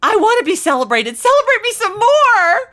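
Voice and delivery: high, loud, hammed-up begging for praise; mock craving praise. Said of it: "we think it would be awful to go".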